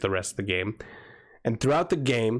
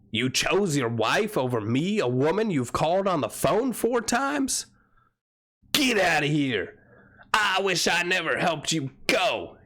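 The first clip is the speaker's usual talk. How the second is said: hammed-up indignation, pitch climbing; imitated scorned outburst; mock outrage; 5 semitones higher than usual. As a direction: play the spurned woman, comically offended. Zoe's outrage at being rejected, acted.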